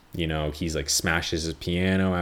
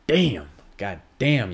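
mock exasperated curse; 4 semitones higher, 4 dB louder, slower than usual. Reacting to film twist.

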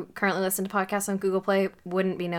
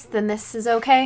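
normal talking voice

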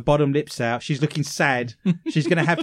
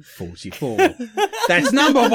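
bingo caller; 6 semitones higher, 3 dB louder than usual. Acted. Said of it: "I've always wanted to be a bingo caller".

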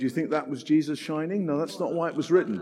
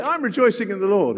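mock announcing; 5 semitones higher, 5 dB louder than usual. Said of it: "sit on a bus and say".

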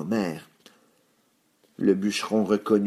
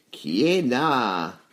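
wolf's big voice, drawn out slowly; slow, heavy wolf voice; big gruff voice; 2 semitones higher, much slower than usual. A narrator's reading. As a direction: ask slowly in a big wolfish voice. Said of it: "demanda une grosse voix".

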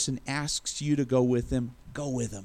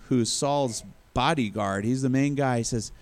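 normal talking voice

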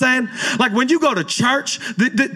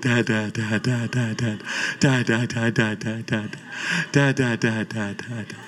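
put-on lower, softer baby babble of dad; imitating baby son; 12 semitones lower, 6 dB quieter than usual. Pastor imitates his eleven-month-old son calling dad, softer and lower. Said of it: "He's like".

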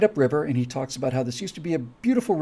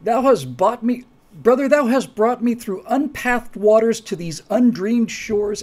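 raised, higher theatrical protest; half-shouted Shakespeare; 6 semitones higher, 3 dB louder than usual. Acted. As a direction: call out indignantly to your brother, stage-actor style. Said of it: "So I kinda shouted him".

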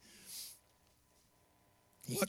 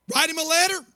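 higher, louder asking voice; mock questioning listener; 10 semitones higher, 7 dB louder than usual. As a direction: play the curious congregant.

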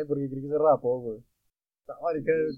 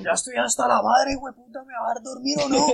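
high, exasperated imitation of a granddad; abuelo harto; 6 semitones higher than usual. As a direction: protest like a grandpa at the end of his patience. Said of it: "Sale el abuelito". (reclamo agudo de abuelo harto, imitado)

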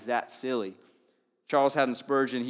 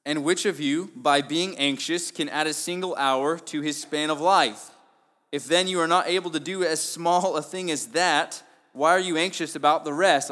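preaching scripture; 5 semitones higher, 6 dB louder than usual. Acted. Preacher reading Jesus' words to the church, forceful. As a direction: read it as a challenge to the congregation.

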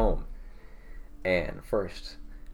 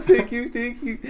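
high-pitched tearful thanks through laughter; mock bawling; 12 semitones higher than usual. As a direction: imitate a bawling first grader, much higher. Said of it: "I'm bawling my eyes out".

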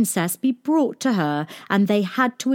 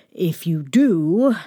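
mild warning; 3 dB louder, slower than usual. A narrator's reading. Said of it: "he wagged his finger at her".

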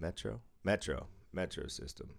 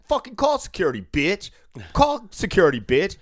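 high, louder imitated drunk yelling; mock angry yell; 8 semitones higher, 7 dB louder than usual. Drunk patron yelling at the worker to call security. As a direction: belt it out like a furious drunk.

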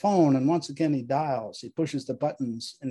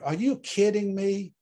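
angry shout; 6 semitones higher than usual. Acted. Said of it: "Shouts in anger".

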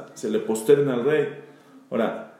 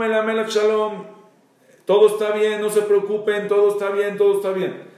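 shouting from afar; 7 semitones higher than usual. Retold or imitated. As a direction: shout so the king won't panic. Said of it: "viene y grita".

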